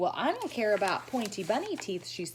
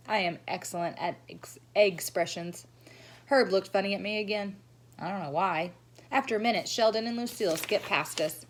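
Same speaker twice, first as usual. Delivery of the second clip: ordinary delivery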